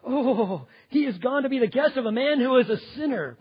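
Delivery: loud, high indignant complaint; hammed-up scandalized grumble; grumbling crowd. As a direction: complain loudly like an outraged crowd. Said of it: "they all began to grumble".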